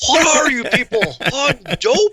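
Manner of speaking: loud, high-pitched indignant teacher outburst; Mister Hand. Acted. Quoting Fast Times at Ridgemont High to the host, indignant Mister Hand. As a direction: exclaim it in high, loud exasperation. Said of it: "I feel like Mister Hand".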